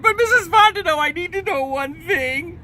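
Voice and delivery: high, loud desperate plea; Louis begging the widow to forgive him, high and loud; pleading. Acted. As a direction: beg her forgiveness with all his heart. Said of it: "Louis said".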